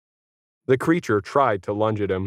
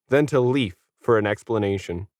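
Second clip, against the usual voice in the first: ordinary delivery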